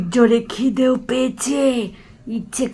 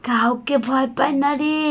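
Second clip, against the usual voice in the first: ordinary delivery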